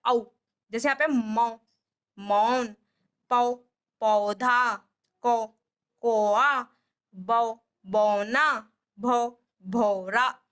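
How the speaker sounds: slow मौन, पौधा, बौना, भौरा with a stretched औ; au matra words demo. Shown as a demonstration. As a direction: draw out the औ in बौना and भौरा for children. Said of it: "औ की मात्रा में औ की साउंड आती है". (औ की मात्रा धीरे खींचकर)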